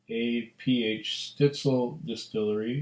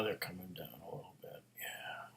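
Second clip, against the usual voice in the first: whispering; 4 semitones lower, 16 dB quieter than usual. Real, not acted. Watching whiskey legs closely.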